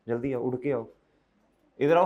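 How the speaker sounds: ordinary delivery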